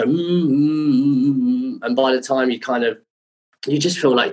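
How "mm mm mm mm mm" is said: string of short high hums; imitated pondering hums. Designer inspecting the rigged-up wings, imitated humming. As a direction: hum like someone appraising the work. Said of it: "he'd kind of go".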